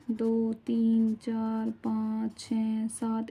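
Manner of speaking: drawn-out count, number by number; slow counting. Real, not acted. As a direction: stretch each number out slowly. Counts out possible repeat numbers.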